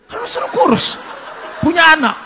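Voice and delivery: very high, loud comic protest; acted indignant shout. Patient to doctor who ordered the diet, indignant shout. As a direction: throw it back in a hammed-up high yell.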